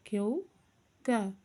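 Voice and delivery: ordinary delivery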